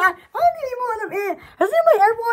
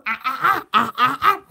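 low, rhythmic machine chugging noise; imitated washing machine; 7 semitones lower than usual. Streamer imitates her noisy washing machine, rhythmic low chug. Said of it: "My washing machine goes".

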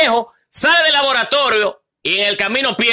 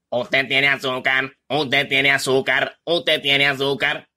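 computer voice, much lower and slow, monotone repeat; voix de robot; 11 semitones lower, 3 dB quieter, slower than usual. Acted. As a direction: speak like a machine printout, slow and low. Computadora repite otra vez.

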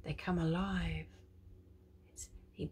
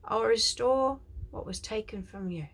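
prophetic declaration; 4 semitones higher, 7 dB louder than usual. Acted. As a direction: proclaim it like a prophet. Quoting God's words.

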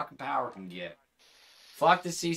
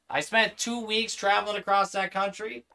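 ordinary delivery

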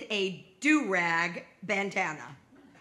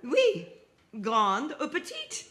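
higher-pitched French shop assistant; French pharmacy clerk; 4 semitones higher than usual. Acted. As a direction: answer in French as a higher-voiced woman behind the counter. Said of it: "the woman at the counter responded".

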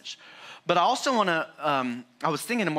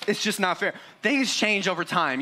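ordinary talk

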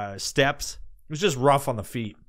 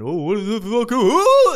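high squealing burst, much louder at the end; mock excited rush; 9 semitones higher, 8 dB louder than usual. Imitating impatient slide riders with a loud yelp. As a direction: yelp like an eager rusher diving in.

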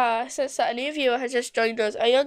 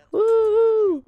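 excited cheer; 10 semitones higher, 5 dB louder than usual. Real, not acted. Cheers at sunny forecast.

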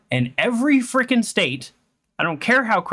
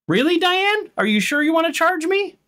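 much higher, disbelieving challenge; incredulous challenge; 12 semitones higher than usual. Pretend. Lawyer taunting Diane over her charge, incredulous and much higher. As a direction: throw it at her like a courtroom gotcha. Mock-confronting game character Diane.

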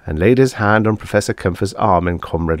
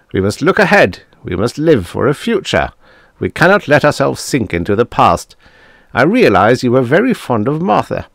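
a touch louder and higher, prodding; hearty Tanzler; 5 semitones higher, 5 dB louder than usual. Acted. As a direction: call him back to attention.

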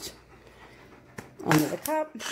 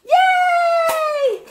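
excited cheer; 4 dB louder than usual. Real, not acted. Dog finds the hidden treat.

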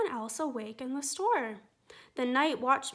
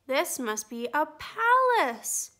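slow, wondering bear voice; small bear amazed by the palace; awed gasp; 2 semitones higher, 7 dB louder than usual. Acted. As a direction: breathe it out in wonder. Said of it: "Corduroy gasped".

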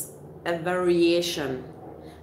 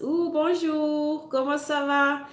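drawn-out, higher sing-song bonjour; playful French greeting; 7 semitones higher than usual. Pretend. Greeting the Maritimes viewer in French, playful sing-song. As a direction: stretch the Ooh, bonjour slowly and lightly, like a musical hello.

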